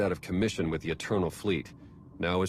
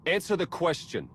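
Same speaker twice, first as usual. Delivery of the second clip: high, hard demand to answer; indignant demand; sharp demand; 11 semitones higher than usual. Acted. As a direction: press the suspect hard. Interrogating a suspected traitor.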